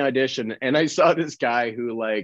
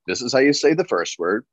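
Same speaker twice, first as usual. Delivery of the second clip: fast rattled-off instructions; 2 semitones higher, much faster than usual. Retold or imitated. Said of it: "the guy who does the accent says".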